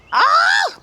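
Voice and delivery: sudden high squeal of joy; a bright yelp of delight. Real, not acted. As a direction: squeal at the sight of it. Reacting to the pulled garlic.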